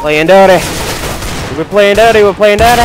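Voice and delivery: high, loud repeated war cry; rowdy shouting. Real, not acted. Gamer getting his own back playing dirty, rowdy shout.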